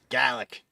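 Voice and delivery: garlic as kalik, r gone in the middle; Boston garlic. Shown as a demonstration. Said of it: "they do it in, like, the middle".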